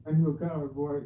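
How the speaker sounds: ordinary talk, nothing marked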